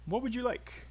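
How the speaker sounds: quick, high listen-and-repeat model; rapid connected "What would you like?"; really fast. Shown as a demonstration. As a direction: squeeze "would you" small between the words, pitched high. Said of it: "practice saying this really fast".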